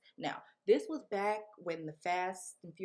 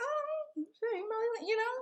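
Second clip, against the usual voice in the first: much higher, coy and cute; coy brush-off; slower than usual. Retold or imitated. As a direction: play it coy and sweet, way up high. Coyly brushing off a celebrity.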